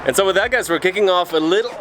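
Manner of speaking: high, energetic intro retake; hyped intro. Real, not acted. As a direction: kick the video off in a high, excited voice.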